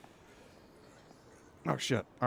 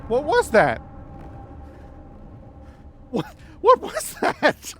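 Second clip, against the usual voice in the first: high, loud, rattled cry; real alarmed shock; 14 semitones higher, 5 dB louder than usual. Real, not acted. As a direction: demand it in rattled shock, breaking into laughter. Reacting to the sudden blast.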